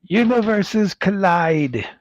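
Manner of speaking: slow, high announcer slogan; trailer voice. Acted. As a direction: read it like a movie trailer line. Reading box slogan dramatically.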